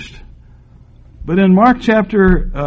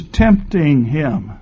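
slow, weighty tempting him; slow stressed reading; 3 semitones lower, much slower than usual. Shown as a demonstration. Preacher stressing the Bible's words, slow and weighty. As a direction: lean hard on tempting him, slowly. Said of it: "notice the last two words".

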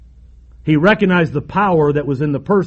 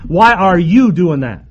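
"you" hit louder in a slow question; single him out with a drawn, punched "you"; stress on YOU; 4 dB louder, slower than usual. Acted. The Lord confronting Saul directly, stress on "you". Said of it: "I'm talking to you right now".

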